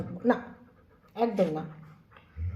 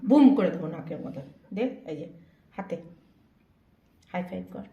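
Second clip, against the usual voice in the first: ordinary talk, nothing marked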